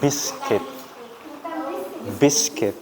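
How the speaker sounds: Biscuit as "BIS-kit", u silent; English pronunciation. Shown as a demonstration. Teacher drilling the correct reading, Biscuit as "BIS-kit". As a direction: repeat Biscuit as two separate syllables, "bis-kit". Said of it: "dibacanya biscuit".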